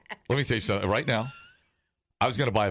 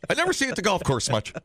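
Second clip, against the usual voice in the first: ordinary delivery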